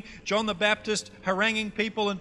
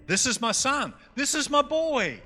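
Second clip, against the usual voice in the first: put-on higher-pitched, proud North Queensland accent; 4 semitones higher than usual. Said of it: "You got my accent?".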